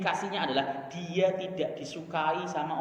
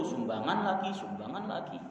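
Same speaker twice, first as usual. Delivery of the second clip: imitating a grumble that sinks lower and softer; mock grumble; 4 semitones lower, 6 dB quieter than usual. Preacher mimics a congregant grumbling at donation requests, mock grumble. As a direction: grumble about yet another donation request, trailing off. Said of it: "Nggak usah kemudian gerutu".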